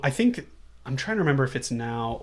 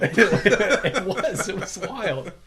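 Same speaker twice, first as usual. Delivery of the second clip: laughing through the words, pitched up; real laughter; 6 semitones higher, much slower than usual. Real, not acted. Agreeing with a friend's text about the movie, laughing. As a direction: agree while cracking up.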